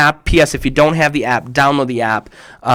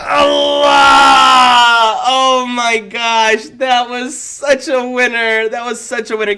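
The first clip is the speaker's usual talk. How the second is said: excited cheer; 9 semitones higher, slower than usual. Real, not acted. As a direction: cheer them on. Cheering the kids' joke.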